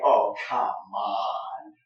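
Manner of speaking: slow, drawn-out exasperated groan of oh come on. Shown as a demonstration. Rodrigo brushing off Iago's warnings, slow and exasperated. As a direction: drag it out like you've had enough of him. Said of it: "something like".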